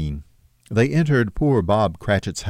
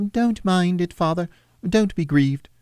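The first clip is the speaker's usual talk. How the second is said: put-on higher, gentle children's voice; comforting children; 10 semitones higher than usual. Little children console their grieving father, higher and gentle.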